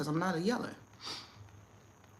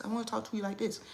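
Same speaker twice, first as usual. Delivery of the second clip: calm soft talk; 3 semitones higher, 6 dB quieter, faster than usual. Shown as a demonstration.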